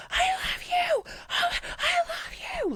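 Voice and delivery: very high shrieking fan voice; mock screaming fan. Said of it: "Like, screaming it".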